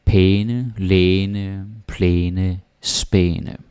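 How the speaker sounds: pæne, læne, plæne, spæne each with a long ae; Danish æne words. Shown as a demonstration. Teacher models æne words with a long vowel. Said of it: "You could say pæne".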